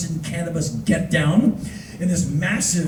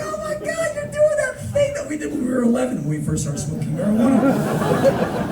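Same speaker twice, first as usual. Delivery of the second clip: mocking friends; 10 semitones higher than usual. Friends teasing the stoned first-timer, mock gushing. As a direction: tease in a put-on high voice.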